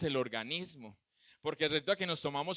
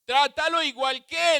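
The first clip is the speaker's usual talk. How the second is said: "Trátalo igual que" higher, as the Lord's instruction; God's command, quoted; 7 semitones higher, 6 dB louder than usual. Retold or imitated. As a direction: quote the Lord firmly. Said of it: "le dijo el Señor".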